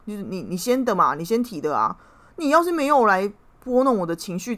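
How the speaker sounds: replaying the argument, fired up again; heated comeback. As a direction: snap back at him, indignant. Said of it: "我马上呛他说".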